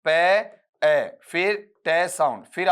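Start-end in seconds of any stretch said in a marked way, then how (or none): 0.0-1.2 "पे, ए" as separate sounds with a gap; broken into sounds. Shown as a demonstration. Teacher splitting potato for students, sound by sound. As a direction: sound out "पे, ए" slowly, first one louder. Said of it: "इस तरीके से आप इसे तोड़ लीजिएगा".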